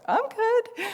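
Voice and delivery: high, drawn-out self-satisfied voice; mock "all is well" tone; smug contentment. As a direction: play it breezy and pleased.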